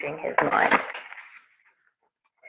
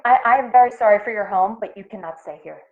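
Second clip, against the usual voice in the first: quick, rushed and louder at first; apologetic blurt that drops away at the end; 4 semitones higher, 8 dB louder, faster than usual. A narrator's reading. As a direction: blurt it out before you lose your nerve. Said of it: "Jitterbug blurted out".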